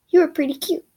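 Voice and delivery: flirty skeleton voice; playful Sans line. Acted. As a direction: tease her sweetly. Sans's line, high and loud.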